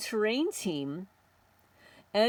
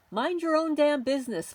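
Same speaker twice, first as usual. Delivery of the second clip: angry scold; 7 semitones higher, 3 dB louder than usual. Real, not acted. Scolding listeners.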